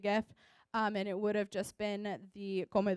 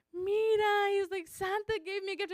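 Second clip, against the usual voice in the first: put-on little kid voice; 9 semitones higher than usual. Little kid quoted telling her.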